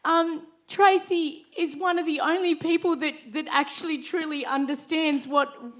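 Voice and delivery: choked up, laughing through tears; fighting back happy tears; teary. Real, not acted. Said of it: "sorry, I'll get all teary".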